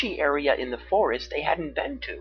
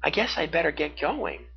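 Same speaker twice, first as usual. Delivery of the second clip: sad goodbye; 6 semitones higher than usual. A narrator's reading. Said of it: "she said sadly".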